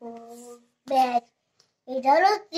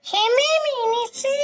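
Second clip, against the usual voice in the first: little pupil's raised, high reply; 8 semitones higher than usual. Pretend. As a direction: answer the teacher in a high, eager voice. Pupil answers pretend teacher.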